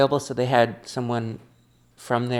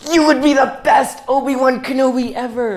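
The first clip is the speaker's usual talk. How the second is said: high, loud gushing compliment; mock fan gushing at an impression; excited praise; 12 semitones higher, 7 dB louder than usual. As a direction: gush like an eager fan. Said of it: "It's like".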